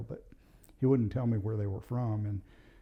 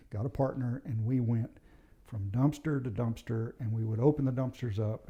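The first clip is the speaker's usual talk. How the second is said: ordinary delivery, nothing marked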